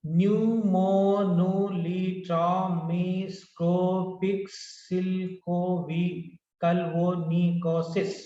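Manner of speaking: "Pneumomonitropic silcoviculvonicosis" very slow, every syllable drawn out evenly. Shown as a demonstration. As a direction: sound out "Pneumomonitropic silcoviculvonicosis" in long, level beats. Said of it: "Nineteen syllables are there".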